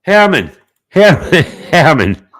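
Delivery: high, loud name-calling with a laugh; repeated calling out. Real, not acted. Streamer hailing a rival who won't answer, calling out. Calls absent Herman repeatedly.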